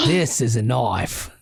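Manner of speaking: put-on slow, low Australian accent; lightly done Australian accent; Paul Hogan Australian. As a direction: drop into a slow Aussie punchline. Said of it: "Little Paul Hogan for you".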